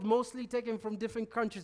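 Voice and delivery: usual speaking voice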